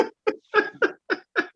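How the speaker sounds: sudden high-pitched cackle; high laugh. Real, not acted. Son laughing at his own shower story, high-pitched cackle. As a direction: burst out laughing.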